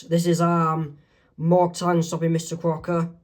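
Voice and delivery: usual speaking voice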